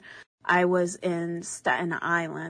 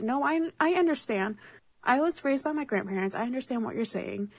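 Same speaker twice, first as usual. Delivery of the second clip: retold reply in a higher voice; lightly acted insistence, pitched up; high-pitched retort; 6 semitones higher than usual. Answering the drunk uncle's doubt, higher-voiced retold retort.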